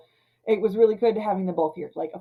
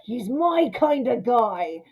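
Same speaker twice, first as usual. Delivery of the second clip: swaggering approval; 4 semitones higher, slower than usual. Retold or imitated. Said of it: "Adventure's like".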